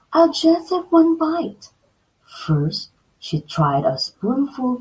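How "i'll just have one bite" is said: put-on high, eager girl's voice; a hungry little girl; Goldilocks, little girl voice. Girl at the bears' table, high hungry voice. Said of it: "Goldilocks said".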